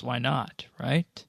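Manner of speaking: usual speaking voice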